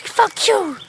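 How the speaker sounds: put-on a little boy throwing a tantrum; angry kid. An angry child lashing out.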